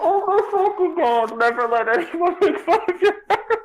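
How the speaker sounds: mocking disbelief. Real, not acted. Friend mocking the fanfic line to the reader, high and incredulous. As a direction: parrot the silly line back in ridicule.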